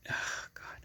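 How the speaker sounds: breathy gasp of effort; strained whisper. Real, not acted. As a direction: push the words out mid-strain. Straining to spin stuck wheel.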